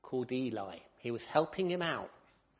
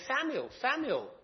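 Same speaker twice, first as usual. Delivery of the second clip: calling out; 11 semitones higher, 5 dB louder than usual. Acted. Voice calls Samuel again.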